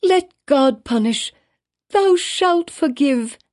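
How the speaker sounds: high, slow, aching insistence; desperate plea. A narrator's reading. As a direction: plead as if her life depends on it. Hester begging the minister.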